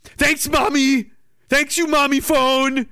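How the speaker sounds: sarcastic thanks to a prudish phone. Retold or imitated. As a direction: sneer thanks at a nagging phone. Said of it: "It's like".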